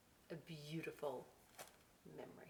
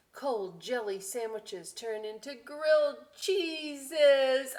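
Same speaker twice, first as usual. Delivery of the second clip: put-on slow, lively read-aloud to children; storybook voice, slow and bright; story reading; 7 semitones higher, 10 dB louder, slower than usual. A narrator's reading. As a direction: savour each line for the kids. Reading book.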